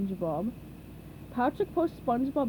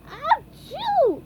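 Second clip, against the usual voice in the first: sneeze; 7 dB louder than usual. A narrator's reading. SpongeBob sneezes.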